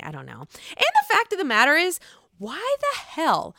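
ordinary talk

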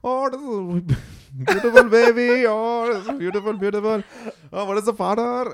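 high sing-song doctor impression; imitating the doctor.

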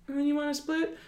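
high, fake-polite offer; sweetly insincere question. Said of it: "the insincere, like".

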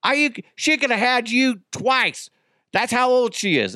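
high-pitched, indignant angry relatives; hammed-up incredulous anger; mock outrage. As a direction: imitate a family loudly taking offense in a high voice. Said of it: "They were so fucking pissed off".

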